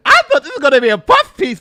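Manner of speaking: loud high laugh through words. Real, not acted. Streamer laughing with delight at the interview, laughing. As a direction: laugh while you talk.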